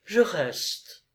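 a woman defying her lover, curt and crisp. A narrator's reading. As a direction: cut it off, decided. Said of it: "d'une voix brève et nette".

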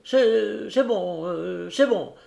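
slightly offended; a bit vexed. Acted. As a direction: mildly put out, brushing it off. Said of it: "fit le Tarasconnais un peu vexé".

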